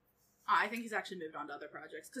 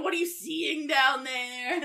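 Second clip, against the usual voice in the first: loud slow mock panic; 5 semitones higher, 10 dB louder, much slower than usual. Imagined thought during pelvic exam.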